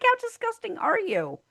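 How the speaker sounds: mock horror at the floss; disgusted quote.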